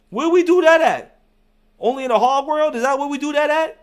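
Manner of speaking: loud, high, exasperated challenge; exasperated rant. Real, not acted. YouTuber calling out angry subscribers, loud and exasperated. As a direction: throw it back at them, fed up.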